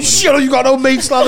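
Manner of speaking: high, loud jeering yell; mock shout. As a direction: yell it back at him. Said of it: "He's going".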